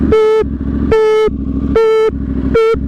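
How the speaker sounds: high flat electronic beeps; truck reversing alarm. Retold or imitated. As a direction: beep like a reversing lorry. Imitating vehicle reversing alarm.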